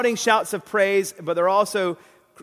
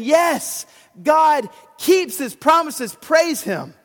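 high, loud joyful shout; excited praise; 10 semitones higher, 6 dB louder, slower than usual. Pretend. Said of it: "feel that excitement saying".

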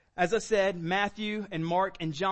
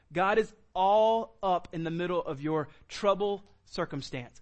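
normal talking voice

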